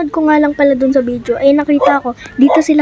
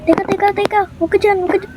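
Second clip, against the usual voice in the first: panicked; 7 semitones higher, faster than usual. Real, not acted. Gun has no bullets, panic.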